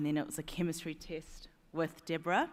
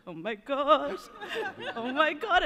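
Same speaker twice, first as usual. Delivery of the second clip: higher and louder, laughing with joy; reliving the moment she read the offer; thrilled disbelief; 9 semitones higher, 6 dB louder, slower than usual. Retold or imitated. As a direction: burst out with giddy excitement. Reading the job offer email.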